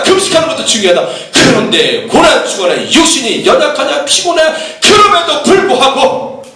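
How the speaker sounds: high, emphatic pulpit exhortation; impassioned preaching. Real, not acted. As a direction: preach in insistent, rising surges.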